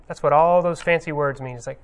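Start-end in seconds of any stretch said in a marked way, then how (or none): none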